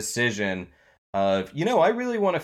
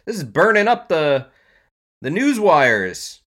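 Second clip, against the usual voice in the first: hyped news read; 6 semitones higher, 5 dB louder than usual. Acted.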